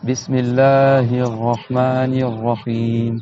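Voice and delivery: very slow chanted recitation; Quran recitation. Shown as a demonstration. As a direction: draw out each word as in tajwid. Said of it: "si vous voulez, on récite ensemble".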